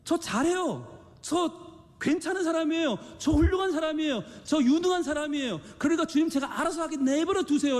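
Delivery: high, self-assured protest; protesting believer. Acted. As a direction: pitch it high and insist you can manage alone. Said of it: "수없이 항변합니다".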